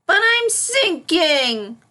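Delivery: high, loud, slow panicked wail; panicked cry. Acted. Filly flailing in the pond, high panicked cry. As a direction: cry out while flailing in water. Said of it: "cried the little unicorn".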